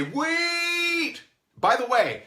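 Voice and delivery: high, loud cry of wait; calling out. Retold or imitated. Prospect calling out to stop the call, high cry. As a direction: call them back before they hang up.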